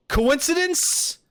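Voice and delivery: very high, drawn-out question; mock suspicion. Streamer teasing chat about the birthday coincidence, mock suspicion. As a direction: squeal it as a leading, drawn-out question.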